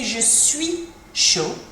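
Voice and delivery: slow, teasing "je suis chaud" as the phrase to avoid; wrong version. Shown as a demonstration. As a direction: say "je suis chaud" slowly as the blush-making mistake. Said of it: "other connotations that might cause some blushes".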